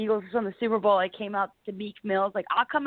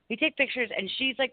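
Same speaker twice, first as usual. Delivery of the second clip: normal talking voice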